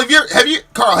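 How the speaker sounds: usual speaking voice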